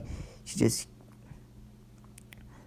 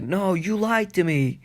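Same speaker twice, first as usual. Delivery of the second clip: high, drawn-out accusation; acted protest; 7 semitones higher, slower than usual. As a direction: wail it as a retold protest. Naruto accuses Lucifer of lying.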